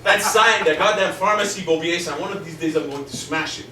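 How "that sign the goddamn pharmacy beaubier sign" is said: irritated rant. Acted. Ranting about the sign.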